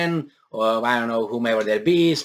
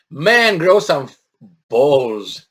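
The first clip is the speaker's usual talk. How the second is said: laughing scorn; 7 dB louder, much slower than usual. Real, not acted. Mocking the minister.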